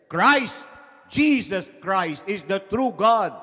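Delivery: loud, high rival claim, drawn out; arguing. Acted. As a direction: top the others with a slow proclamation. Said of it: "they were arguing".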